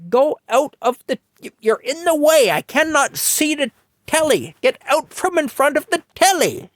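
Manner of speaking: put-on high, loud older British lady shooing a child; Mattie shooing the kid. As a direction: fuss at the kid blocking her telly. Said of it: "And she's there".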